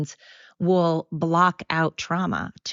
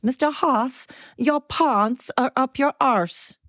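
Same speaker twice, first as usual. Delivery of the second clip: high, exaggerated South African imitation; bad South African accent; 9 semitones higher, slower than usual. Speaker contrasting the student's line with her own, mock South African accent. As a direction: pitch it high and slow in a badly faked South African accent. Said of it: "your accent justice".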